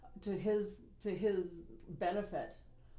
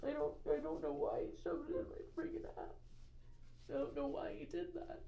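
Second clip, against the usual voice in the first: tearful; 9 semitones higher, 4 dB quieter than usual. Real, not acted. Tearful admission about the assault.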